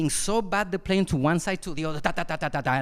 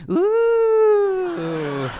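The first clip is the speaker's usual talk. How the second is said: mock teenage whoop; 13 semitones higher, 6 dB louder than usual. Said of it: "teenagers that says".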